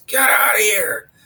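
imitated booming bellow, pushed up high; Jeff's booming yell. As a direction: re-enact a big-voiced man roaring at a bear. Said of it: "got a real deep booming voice".

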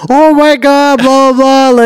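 high, loud mock amazement; high-pitched put-on gushing; gushing retold excitement. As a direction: imitate someone bursting in, all thrilled. Mailman bursts in, gushing.

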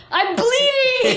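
high, slow laughing plea; distressed. Retold or imitated. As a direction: wail it through a laugh. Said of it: "I'm like".

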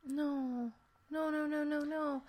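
high, soft string of no's; dismayed pleading. Real, not acted. Listener hears about the hidden box cutter, softly dismayed. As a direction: quietly plead against what's coming. Dreading the murder being described.

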